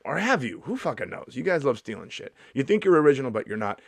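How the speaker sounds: ordinary delivery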